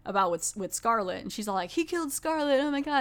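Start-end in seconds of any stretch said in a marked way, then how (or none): 1.7-3.0 high-pitched frantic imitation; mock alarm. Vendetta telling everyone he killed Scarlet, mock alarm. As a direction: blurt it high, like someone panicking. Retelling Vendetta's panicked accusation.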